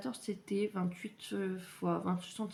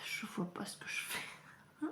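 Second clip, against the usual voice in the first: whisper; 9 dB quieter than usual. Real, not acted. Aside while threading.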